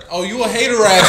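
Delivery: joking God. Acted. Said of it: "God was like".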